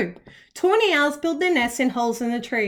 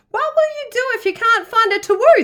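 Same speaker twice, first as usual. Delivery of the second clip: higher, louder asking; worried animals; 9 semitones higher, 4 dB louder than usual. A narrator's reading. Animal friends ask Olive, concerned. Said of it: "they asked".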